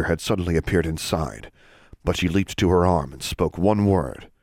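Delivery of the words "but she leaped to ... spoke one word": usual speaking voice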